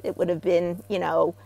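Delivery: ordinary talk, nothing marked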